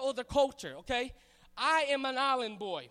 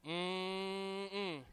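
savoring hum; 7 semitones lower, 8 dB quieter than usual. Acted. Savoring barbecue.

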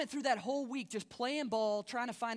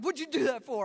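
louder, higher mock-angry protest; indignant, raised-voice retelling of a protest; retold indignant outburst; 4 semitones higher, 7 dB louder than usual. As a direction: blurt it out like you almost swung at him. Indignant after being held underwater.

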